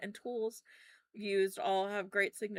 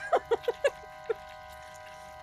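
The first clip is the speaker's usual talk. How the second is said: breathless wheezing laugh. Real, not acted. Laughs at the idea.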